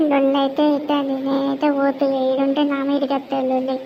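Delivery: normal talking voice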